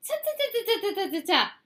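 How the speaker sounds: dismissive interruption. A narrator's reading. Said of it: "interrupted Fox".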